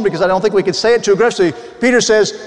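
ordinary talk

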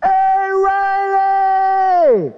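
long slow high call from far off; distant shout. Retold or imitated. Youth director calling Riley through the woods, long slow high shout. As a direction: call across the woods, drawing it out. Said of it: "I heard the voice of that youth director".

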